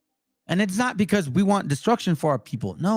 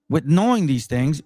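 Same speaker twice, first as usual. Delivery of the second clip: normal talking voice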